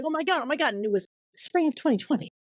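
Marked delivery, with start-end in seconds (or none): 0.1-0.7 high, breakneck excitement re-enacted; retold amazement. Author seeing her proposal go out, amazed. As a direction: act out giddy disbelief, pitched high. Reliving shock at pitch going out.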